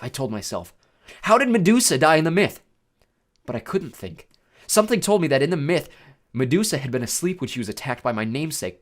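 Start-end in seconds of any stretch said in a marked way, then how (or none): 1.2-2.6 louder, higher urgent question; pressing, raised voice; urgent self-questioning. A narrator's reading. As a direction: rack your memory under pressure. Panicked self-questioning in thought.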